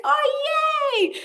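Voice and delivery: very high, loud delighted squeal; squealing with delight at the photo. Retold or imitated.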